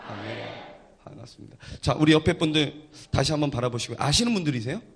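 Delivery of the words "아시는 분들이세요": normal talking voice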